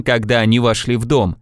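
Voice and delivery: plain reading voice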